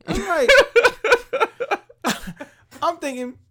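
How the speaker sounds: high burst of real laughter; laughing hard. Real, not acted. Laughing out loud while telling the coworker about the boss. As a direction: breaks up laughing at the memory. Cracking up at his own story.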